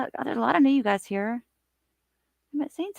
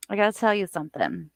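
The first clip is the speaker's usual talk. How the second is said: usual speaking voice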